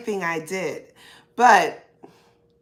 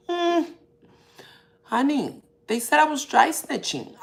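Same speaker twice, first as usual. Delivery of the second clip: higher, sassy "Honey, they said I was dry snitching"; sassy retelling; 7 semitones higher than usual. Retold or imitated. Telling viewers again what the family called her, sassy and higher. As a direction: lean in with sass on the repeat.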